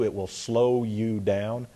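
ordinary talk

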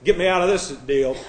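usual speaking voice

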